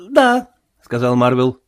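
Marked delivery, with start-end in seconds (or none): 0.1-0.5 quick high-pitched yes; nervous Marvel. A narrator's reading. Marvel confirming to the sailor, nervous and high. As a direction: answer in a small raised voice.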